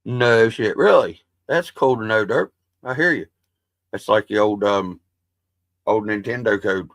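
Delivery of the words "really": ordinary talk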